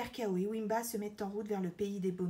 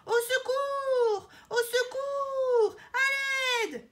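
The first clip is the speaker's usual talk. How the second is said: very high, drawn-out yell; screamed cry for help; 8 dB louder, much slower than usual. A narrator's reading. Elephant fallen in a trap calling out, screaming. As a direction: wail loudly for rescue. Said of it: "hurle une voix".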